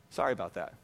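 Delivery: low, soft mock apology; quiet, low-pitched sheepish reply. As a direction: drop right down for a small retold sorry. Retold sheepish reply to his pastor.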